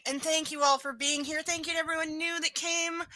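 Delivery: ordinary delivery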